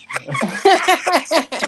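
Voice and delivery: bursting out in a high shriek of laughter; a big squealing laugh; loud high laugh. Real, not acted.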